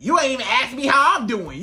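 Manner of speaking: ordinary talk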